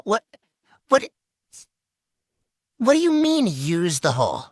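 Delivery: stunned, can't believe what he heard; shocked disbelief. Acted. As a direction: voice jumps way up in disbelief. RA shocked by confession.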